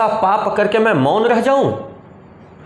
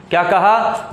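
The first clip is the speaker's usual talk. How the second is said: ordinary talk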